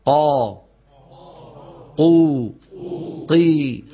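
"قا، قو، قي" each alone, firm, no puff of air; model qaf syllables. Shown as a demonstration. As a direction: ring out each qaf in "قو" and "قي" cleanly, held. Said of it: "اسمعوا جيدا".

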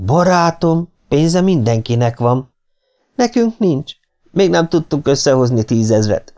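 high-pitched mock astonishment; feigned surprise. A narrator's reading. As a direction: act surprised, pitched well up. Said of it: "úgy tett, mint aki csodálkozik". (magas hangú, színlelt csodálkozás)

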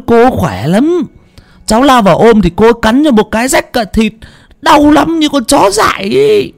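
high-pitched boy blurting out bad news; breathless, alarmed youngster's report; panting, panicked. A narrator's reading. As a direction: stammer it out frantically in a very high voice. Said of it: "Thằng Tú thở hồng hộc".

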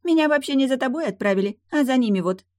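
high-pitched, loud mermaid voice; haughty mermaid. Acted. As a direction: put him in his place, pitched high. Mermaid explains herself.

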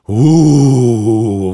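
loud ecstatic wailing howl. Acted. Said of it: "в экстазе выл старик".

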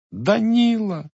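high, anguished scream of his name; wife throwing herself at husband's feet, desperate cry. Acted. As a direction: cry out to stop him. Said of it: "закричала в отчаянии".

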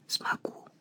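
barely audible, voiceless whisper. A narrator's reading. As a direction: whisper it almost without sound. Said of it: "Аяко шепнула".

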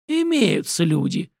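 slow, hoarse rasp. A narrator's reading. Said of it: "хрипел стрелок".

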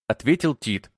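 usual speaking voice

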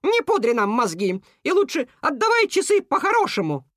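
high, insistent bird voice; indignant demand. A narrator's reading. Cuckoo ordering the beaver to return watches, indignant. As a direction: demand it, climbing in pitch.

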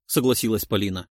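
plain reading voice

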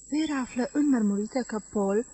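plain reading voice

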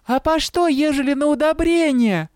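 put-on very high child's voice; child exclaiming. A narrator's reading. Small child shouting a question at the crowd, very high pipe. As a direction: pipe it up like a little kid. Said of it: "воскликнул какой-то ребятёнок".

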